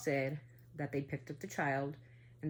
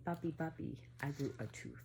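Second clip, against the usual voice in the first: put-on creepy demon child; 6 dB quieter than usual. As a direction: say it quietly like a threat. Daughter's drawing, demon's words.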